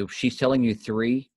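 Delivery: usual speaking voice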